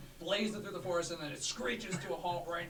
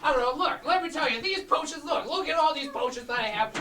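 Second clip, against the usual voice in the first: potion seller; 11 semitones higher, 12 dB louder than usual. Acted.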